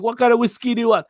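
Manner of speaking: hammed-up insistent uncle, quick and high; pushy host. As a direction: rush the guest into a drink. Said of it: "he's like".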